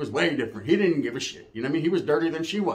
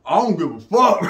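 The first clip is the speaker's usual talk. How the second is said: louder, laughing punchline; laughing shout; 3 dB louder than usual. Real, not acted. Speaker delivering the punchline to his audience, laughing shout. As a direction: blurt it out cracking up.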